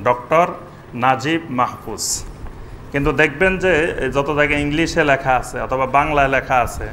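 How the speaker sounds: ordinary talk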